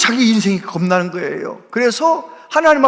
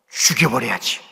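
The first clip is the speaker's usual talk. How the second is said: murderous thought; 9 semitones lower than usual. Retold or imitated. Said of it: "살기가 느껴지더래".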